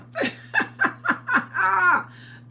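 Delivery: 長く高い笑い声; long high laugh. Real, not acted. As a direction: roll into a long high cackle. Calling herself a clown.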